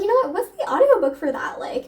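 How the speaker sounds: bright sudden idea, voice jumping high; excited inner voice. Retold or imitated. Telling viewers her bus-ride idea, excited. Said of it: "And I was like".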